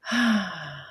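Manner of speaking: long falling open sigh; demonstrated "ha" breath. Shown as a demonstration. Teacher shows people the breath, long sighed ha. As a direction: release the breath in a drawn-out ha. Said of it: "This is the breath, do it".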